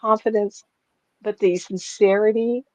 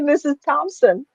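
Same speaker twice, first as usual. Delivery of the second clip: put-on slow, high-pitched British accent; lightly imitated British accent; British lady; 7 semitones higher than usual. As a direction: introduce yourself slowly as a British lady. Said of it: "she had a British accent".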